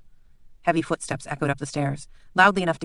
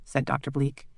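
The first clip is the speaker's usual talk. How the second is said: ordinary delivery, nothing marked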